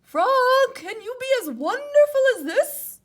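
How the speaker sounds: high, loud boast; boastful Toad. Acted. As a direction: brag to Frog.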